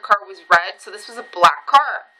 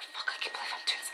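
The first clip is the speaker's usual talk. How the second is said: hushed, quick aside; muttering under breath; 20 dB quieter, faster than usual. Retold or imitated. Swallowing pride to call head office, muttered low and fast. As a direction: say it barely audibly, hurried. Muttering to herself while calling.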